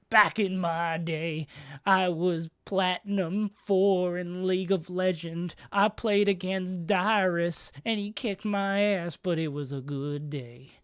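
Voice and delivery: put-on slow old man voice; slow, creaky old-timer reminiscing. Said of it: "I don't know what this voice is".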